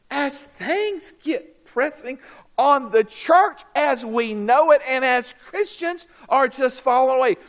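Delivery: high, driving pulpit delivery; forceful preaching. Acted. Preacher warns that Christians are falling away, forceful. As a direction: press the point on the congregation.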